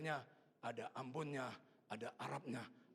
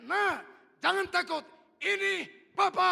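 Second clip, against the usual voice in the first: high, full-voiced yell, slow at the end; father's shout; 17 dB louder, slower than usual. Father shouts to his child in the ditch, very loud. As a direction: shout reassurance across a distance. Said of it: "Saya teriak".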